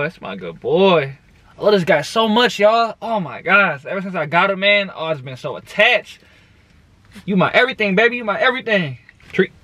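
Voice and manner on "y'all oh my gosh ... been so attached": ordinary delivery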